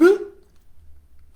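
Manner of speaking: loud, high stage gasp; big theatrical intake of breath; astonished gasp. Acted. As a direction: gasp in mock amazement. Mock amazement at pig's ribbit.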